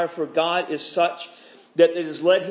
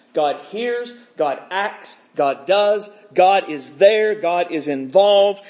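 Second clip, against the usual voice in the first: each short claim louder and slower, building; preaching build-up; 4 dB louder, slower than usual. Acted. Preacher rallying the congregation, building louder and slower. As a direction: hammer each line home like a preacher.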